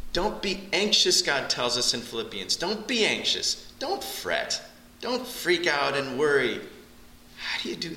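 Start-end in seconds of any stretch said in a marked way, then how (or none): 0.1-1.2 high, louder "anxious" pushed out; urgent exhortation. Acted. Preacher pleads with his church not to worry, high and urgent. 2.6-3.7 high and slow, "be" leaned on; slow it right down and plead it; slow pleading repeat. Acted. Preacher repeats God's command to his church, high and slow.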